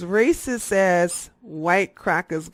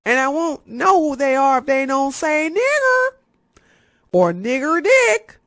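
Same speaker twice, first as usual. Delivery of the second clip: much higher, louder put-on voice; 7 semitones higher, 6 dB louder than usual. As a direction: squeal the slurs mockingly. Imitating racists saying slur.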